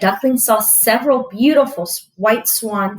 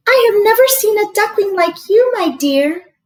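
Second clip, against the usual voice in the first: higher, louder doting mother duck; 11 semitones higher, 5 dB louder than usual. Acted. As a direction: coo at the odd new chick like a proud mother.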